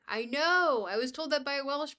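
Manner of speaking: loud, delighted I know; excited agreement. Real, not acted. Streamer telling chat about Welsh words, loud delighted agreement. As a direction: burst out with happy agreement.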